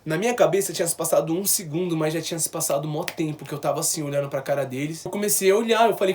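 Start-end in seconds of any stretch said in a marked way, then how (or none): none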